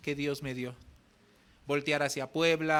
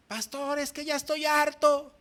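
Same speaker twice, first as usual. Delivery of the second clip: higher, louder angry outburst; fed-up yell; 11 semitones higher, 6 dB louder than usual. Retold or imitated. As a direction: shout it like he's had enough. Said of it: "se enojó con el pastor y le gritó".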